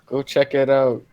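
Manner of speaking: usual speaking voice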